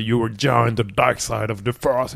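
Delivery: low, slow, soft villain voice; deep, measured villain impression in English; Darth Vader. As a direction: imitate a menacing dark lord, drawn out. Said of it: "c'est la vraie voix de Dark Vador".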